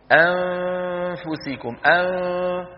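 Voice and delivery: أنفسكم drawn out very slowly with a held nasal ن; nasal demo. Shown as a demonstration. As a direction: stretch أنفسكم to show the hidden ن. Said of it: "نقول". (أنفسكم بغنة ممدودة ببطء)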